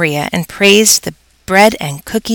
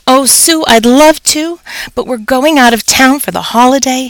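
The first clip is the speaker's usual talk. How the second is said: higher, apologetic refusal; apologetic principal; 6 semitones higher than usual. A narrator's reading. Principal turning down the teacher, higher and apologetic. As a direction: let her down gently. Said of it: "he told her".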